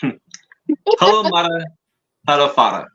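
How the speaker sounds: put-on accent meant as Italian; exaggerated foreign accent, vaguely Italian; mock Italian-sounding accent. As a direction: do a rough, comic Italian-style accent. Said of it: "I'm not gonna do the accent".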